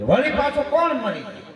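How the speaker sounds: what, who died now?; mock baffled shopkeeper; puzzled question.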